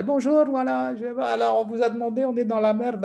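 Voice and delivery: lightly voiced Gulf sheikha admitting trouble; breezy sheikha. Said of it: "elle me parle français".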